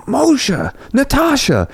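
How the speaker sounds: high, drawn-out name call; retold calling out. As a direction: imitate someone hailing friends from behind. Retold calling from behind them.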